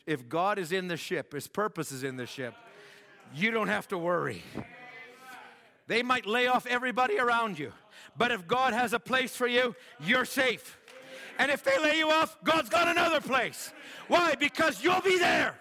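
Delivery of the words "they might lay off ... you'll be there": high, loud shouted climax; full-force preaching. Real, not acted. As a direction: preach it to the rafters.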